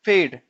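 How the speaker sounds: फेड said loud as a clear model word; sounding it out. Shown as a demonstration. Teacher asking learners to try it, फेड sounded out loudly. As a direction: sound out फेड firmly, on its own. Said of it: "खुद एक बार sound करके देखो".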